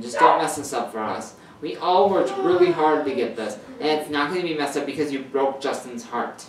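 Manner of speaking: loud, emphatic telling-off; scolding. A narrator's reading. Caden warning Vinny not to ruin things, scolding.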